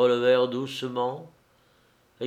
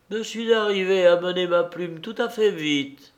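higher, lightly voiced distant young clerk; a hint of a feverish friend, higher; dazed young man; 7 semitones higher, 3 dB louder than usual. A narrator's reading. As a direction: say it absently, lost in a daze. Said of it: "dit-il enfin".

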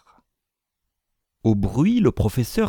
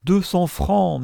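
high, pained echo; high-pitched moan, not hushed; dismayed murmur; 10 semitones higher, slower than usual. A narrator's reading. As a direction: repeat the sum in dismay. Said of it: "murmura le banquier".

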